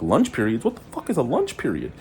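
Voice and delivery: ungläubig, höher, ausgerufen; incredulous. Retold or imitated. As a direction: spit out the words in disbelief. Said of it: "And I'm like".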